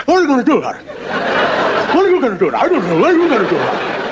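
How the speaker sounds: high, squeaky frog voices, laughing through; a crowd of panicked little frogs; frogs chattering. Acted. Frogs asking each other in high squeaky voices. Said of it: "So they all go".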